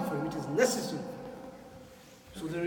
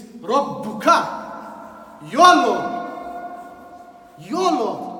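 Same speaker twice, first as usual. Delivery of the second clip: Rabbuka drawn out slow and breathy, then your Lord rising much louder and higher; emphatic proclamation; 4 semitones higher, 11 dB louder, much slower than usual. Real, not acted. Preacher calling out to the young friends, loud emphatic proclamation. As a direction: thunder the title out to the congregation. Preacher stresses the divine title.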